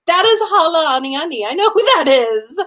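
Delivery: high, loud, hurt accusation; betrayed anger. Retold or imitated. Grandmother accusing her granddaughter of betrayal, high and loud. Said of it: "Waka feels very betrayed".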